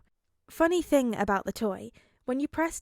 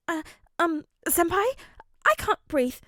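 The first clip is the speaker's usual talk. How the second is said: squeezed flustered voice; 7 semitones higher, 5 dB louder than usual. Acted. Crushed in hug.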